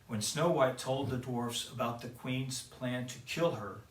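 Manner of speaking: ordinary delivery, nothing marked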